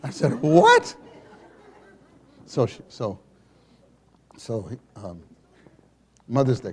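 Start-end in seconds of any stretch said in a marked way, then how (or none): 0.4-0.9 high, loud laughing what; shocked, imitated; high, loud mock surprise; startled. Startled reply.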